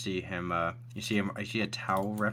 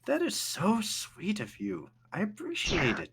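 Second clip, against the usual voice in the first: sweet high character voice; 10 semitones higher than usual. Acted. Brooke in towel thanking captain, high sweet voice. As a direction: gush sweetly over the dinner.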